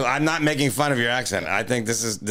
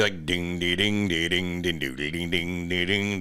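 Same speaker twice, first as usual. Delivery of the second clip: humming guitar notes; 4 semitones lower, 4 dB quieter, slower than usual. Shown as a demonstration. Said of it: "the only thing I can't play".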